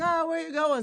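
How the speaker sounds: high mock Italian-accent question; nosy Italian-accent call. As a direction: imitate the pushy Italian stranger. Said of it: "talk to me with this accent".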